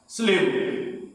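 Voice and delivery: ordinary delivery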